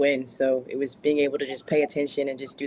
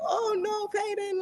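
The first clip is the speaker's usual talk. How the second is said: very high put-on German granny; squeaky mock German grandma; high-pitched imitation of a German grandmother; German grandmother accent; 3 dB quieter, slower than usual. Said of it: "the accent's heavy".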